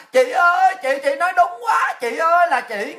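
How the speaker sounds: high, loud pleading wail; hammed-up desperate yelling; mock crying out. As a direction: cry out mockingly to big sister. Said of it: "là tại sao mà la làng là".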